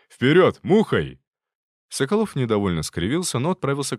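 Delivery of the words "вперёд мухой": louder, sharp order; loud command. Acted. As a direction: bark them into moving. Mentor orders hurry.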